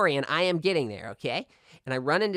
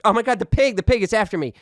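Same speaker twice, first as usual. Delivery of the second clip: higher, louder frantic cry; frantic kid; 6 semitones higher, 6 dB louder than usual. Retold or imitated. Boy telling the vice principal about the pig, frantic. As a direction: blurt out the terror. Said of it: "And I was like".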